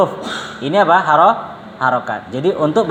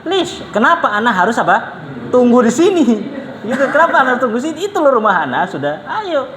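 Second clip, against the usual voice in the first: higher, baffled and laughing as the Yemeni host; confused host; 5 semitones higher than usual. Retold or imitated. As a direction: laugh through it, why wait here. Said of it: "Orang Yamannya bingung".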